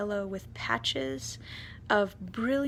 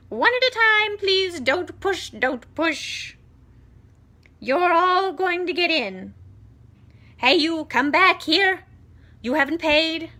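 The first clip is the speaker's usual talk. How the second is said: shouting; 9 semitones higher, 11 dB louder than usual. Acted. Aunt shouting at the crowd.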